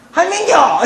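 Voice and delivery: high, loud Gyeongsang holler; quick-tempered Gyeongsang dialect. Acted. Hot-tempered Gyeongsang grandchild greeting grandma, loud dialect burst. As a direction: fire it out as one abrupt Gyeongsang shout. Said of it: "세자로 줄이지".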